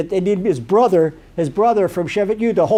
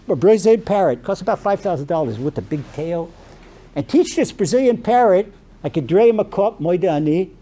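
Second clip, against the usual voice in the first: normal talking voice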